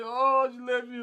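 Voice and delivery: loud mock whine of someone dumped; whiny self-pity. Mocking a dumped person's loud self-pity. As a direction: moan it like a sorry victim.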